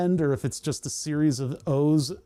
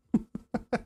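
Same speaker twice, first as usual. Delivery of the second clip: laugh; 6 semitones higher, 4 dB quieter than usual. Real, not acted. Laughs at own attempt.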